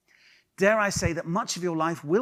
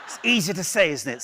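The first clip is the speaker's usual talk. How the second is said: usual speaking voice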